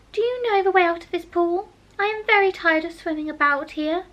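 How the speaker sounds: put-on a tired girl asking politely; Alice's voice. A narrator's reading. Alice asks the mouse the way out, lightly girlish.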